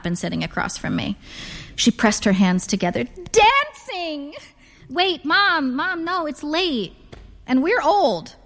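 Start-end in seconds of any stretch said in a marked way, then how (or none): 3.0-4.3 high, drawn-out delighted cry; gleeful exclamation. A narrator's reading. Mother proposes dancing with delight. 4.9-8.3 high-pitched objection to Mom; pleading protest. A narrator's reading. Daughter protests mother's dancing plan.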